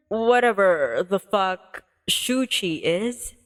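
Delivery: ordinary talk, nothing marked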